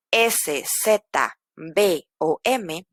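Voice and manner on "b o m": ordinary talk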